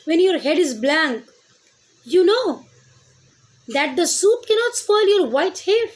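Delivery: teacher reciting the comforting verse aloud; poem recitation. Acted. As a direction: declaim the line to the class. Recites the poem line loudly.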